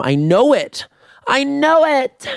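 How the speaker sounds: building to a high-pitched mock shriek; hammed-up hypochondriac freak-out; health-anxiety panic. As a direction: escalate into squealed, drawn-out certainty. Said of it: "I will feel a flutter ... and be like".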